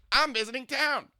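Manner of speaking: high, loud, sing-song camp voice; imitating a flamboyant older man. To a stranger on the subway, gushing camp impression. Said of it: "I imitate people".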